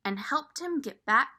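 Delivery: ordinary delivery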